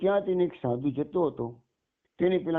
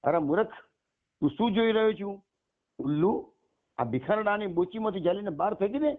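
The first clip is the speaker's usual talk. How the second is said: higher, angry command to the servant; a furious mistress ordering her servant to throw the monk out; 6 semitones higher than usual. Acted. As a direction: bark the order, stamping in rage. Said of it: "પગ પછાડી ગુસ્સામાં હુકમ કર્યો".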